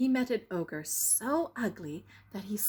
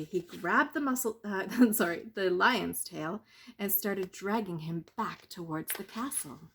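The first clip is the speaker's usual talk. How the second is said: usual speaking voice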